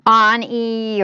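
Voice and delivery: "on E" said like the word on, then a long ee; "on E" with an open short O, then ee; alternative ticker reading. Shown as a demonstration. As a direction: say "on E" as AHN-ee. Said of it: "however you wanna pronounce this ticker".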